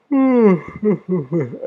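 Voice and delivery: high, loud hummed chuckle; wordless chuckle. Real, not acted. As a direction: hum into a little run of chuckles. Reacting to a viewer's message.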